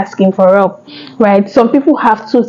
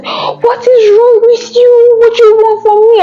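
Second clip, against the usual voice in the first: high loud frantic shout; shouting at the baby; 13 semitones higher, 3 dB louder than usual. Retold or imitated. Overwhelmed mother shouting at her newborn, loud shout. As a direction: shout it out, at the end of your rope. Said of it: "I just started shouting".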